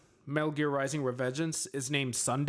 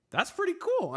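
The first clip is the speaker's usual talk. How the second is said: delighted. Retold or imitated. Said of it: "And I was like".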